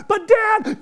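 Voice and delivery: put-on high, loud little-girl plea; child protesting. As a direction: cry out like a kid in trouble. Daughter protesting.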